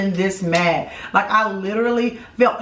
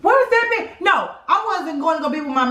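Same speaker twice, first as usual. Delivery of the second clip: indignant; 7 semitones higher than usual. Real, not acted. Couple bickering.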